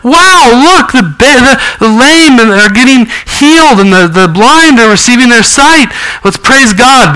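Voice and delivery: high, excited voice of delighted onlookers; imagined Pharisees rejoicing. Retold or imitated. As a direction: gush with joy over the healings, pitched well up. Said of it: "The Pharisees should have gone".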